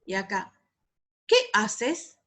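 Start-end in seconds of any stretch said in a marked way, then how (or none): 1.2-2.2 "Qué haces" with questioning tune; question intonation. Shown as a demonstration. Teacher contrasting question with exclamation, questioning. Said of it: "Es muy importante cuando, el tono de voz".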